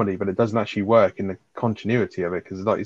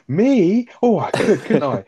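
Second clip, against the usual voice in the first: higher, louder, feigned eagerness; mock eager; 9 semitones higher, 4 dB louder, much slower than usual. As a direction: Dale pretending the idea just struck him, hammed-up eagerness. Acted sitcom dialogue.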